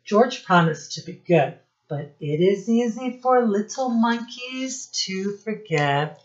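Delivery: ordinary delivery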